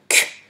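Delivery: Q as a short, hard "k"; isolated sound. Shown as a demonstration. Teacher showing the strong sound of C, Q as bare "k". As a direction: release Q as a bare "k", like cochon's start. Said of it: "Un son fort".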